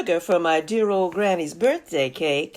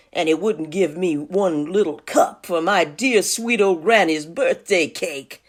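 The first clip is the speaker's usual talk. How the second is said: ordinary delivery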